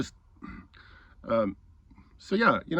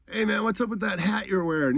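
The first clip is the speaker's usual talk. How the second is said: needling challenge; 8 semitones higher than usual. Retold or imitated. Acts out needling the man.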